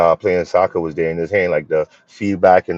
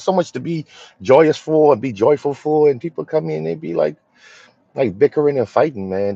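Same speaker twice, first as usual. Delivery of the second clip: normal talking voice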